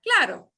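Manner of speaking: "Claro" with one quick tap on r; single flap. Shown as a demonstration. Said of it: "Single flap, claro".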